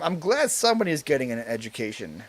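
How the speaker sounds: normal talking voice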